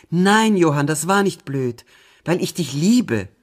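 high, loud impassioned protest; cried out. A narrator's reading. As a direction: burst out in heartfelt contradiction. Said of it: "rief ich". (laut und leidenschaftlich gerufen)

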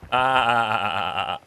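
broken-up imitation goat bleat, loud then fading; badly imitated goat in short repeated pulses; stuttering goat bleat. Shown as a demonstration. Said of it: "I can't really do a goat sound".

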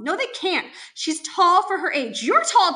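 high, loud, climbing protest; agitated outburst, rising to a shout; frantic denial. Acted. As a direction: deny it desperately, pitch shooting up. Mother's frantic denial in quarrel.

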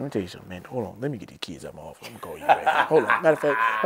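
ordinary talk, nothing marked

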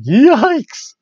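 high, loud startled yelp; shocked yelp. Retold or imitated. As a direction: blurt it out in alarm. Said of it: "my friend saw that text and he's like".

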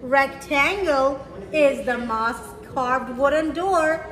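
usual speaking voice

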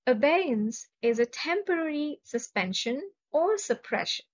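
ordinary delivery

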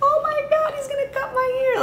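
very high frightened squeal; panicked squeal. Retold or imitated. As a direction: squeak it out in terror.

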